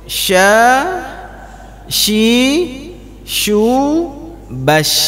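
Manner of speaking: sya shi shu with strong sh air; hissy sya, shi, shu; teacher models shin; shin drill. Shown as a demonstration. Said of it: "huruf shin dari mana keluarnya".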